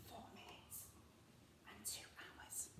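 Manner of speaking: very soft, secretive whisper. Shown as a demonstration. Teacher modelling the ordered times, whispering. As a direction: barely breathe the words. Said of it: "So can you whisper those times".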